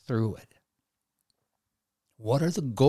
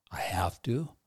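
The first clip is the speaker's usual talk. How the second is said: reluctant whisper, voice unvoiced and softer; whispered have to; 3 semitones lower, 6 dB quieter than usual. Shown as a demonstration. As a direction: breathe it out like a grudging duty. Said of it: "or "I want to".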